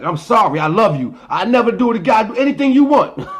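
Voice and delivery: high, loud, whiny begging that breaks into a laugh; mock pleading apology. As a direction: beg like a freshly whipped kid, hamming it up. Mock beaten kid begging forgiveness.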